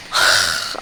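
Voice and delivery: low, long unvoiced sigh; contented sigh. Real, not acted. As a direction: breathe out in longing. Craving the smell of ribs.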